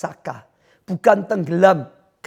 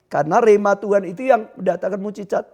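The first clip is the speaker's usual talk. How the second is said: ordinary talk, nothing marked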